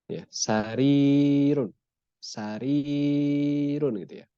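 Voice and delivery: sarirun slowly, the long i held out; long vowel. Shown as a demonstration. Teacher drills the long vowel for students, slow and drawn out. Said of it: "Jadi panjang".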